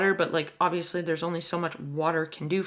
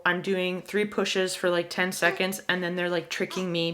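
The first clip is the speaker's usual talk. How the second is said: normal talking voice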